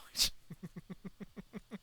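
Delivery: unvoiced high wheezing laugh; a quick silent-ish giggle; breathy high giggle. Real, not acted. Laughing at his own joke.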